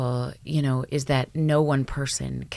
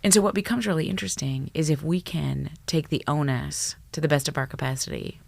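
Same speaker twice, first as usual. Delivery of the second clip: usual speaking voice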